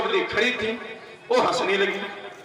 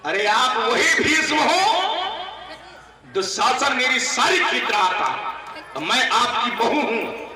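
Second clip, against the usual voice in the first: Draupadi taunting; 4 semitones higher than usual. Acted. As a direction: throw it back at him with a bitter laughing taunt. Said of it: "Draupadi बोली".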